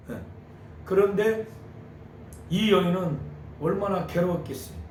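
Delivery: normal talking voice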